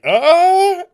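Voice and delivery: a sudden confused yelp; startled uh. Retold or imitated. Courtney reacting to Cindy at her door, startled. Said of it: "is like".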